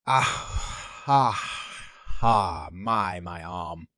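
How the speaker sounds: gasping, breathy cry of pain; pained gasps. Acted. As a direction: gasp it out, fading in agony. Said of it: "a wave of pain washed over him".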